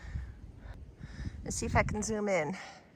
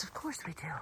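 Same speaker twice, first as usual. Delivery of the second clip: resigned breathy whisper; 2 semitones lower, 15 dB quieter than usual. Real, not acted. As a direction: whisper it to yourself, worn out. Exhausted aside while climbing.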